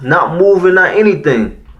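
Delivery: ordinary delivery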